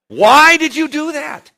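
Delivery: high, loud, slow exclamation; big rising preacher cry. Real, not acted. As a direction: throw the question out at full force. Preacher shouting at audience.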